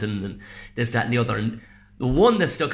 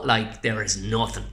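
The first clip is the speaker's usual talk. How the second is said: usual speaking voice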